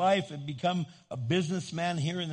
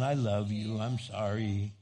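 put-on low, meek teenage apology; pitched way down, mock-contrite; calmed down; 6 semitones lower than usual. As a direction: imitate a teen sheepishly making up. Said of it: "And then they calm down".

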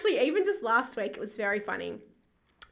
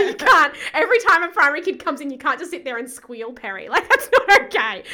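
laughing scold; 5 semitones higher, 11 dB louder than usual. Retold or imitated. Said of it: "They're like".

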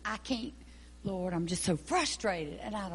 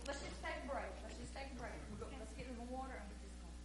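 much softer; 16 dB quieter, much faster than usual. Acted. Leader calling a break, speaking very softly. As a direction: drop the voice right down. Backing off from the argument.

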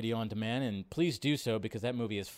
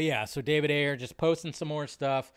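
ordinary talk, nothing marked

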